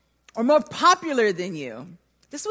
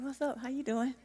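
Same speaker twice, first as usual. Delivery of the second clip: much softer, shy small voice; nervous and shy; 15 dB quieter than usual. Retold or imitated. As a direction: barely dare to speak to a cute boy. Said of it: "I'm all nervous and shy".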